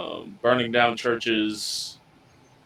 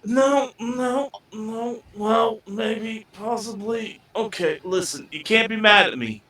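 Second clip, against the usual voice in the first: high-pitched, hesitant confession; cagey crew member confessing; 10 semitones higher than usual. Acted. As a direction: confess in a high voice, backpedalling and placating. In-character reluctant confession to crew.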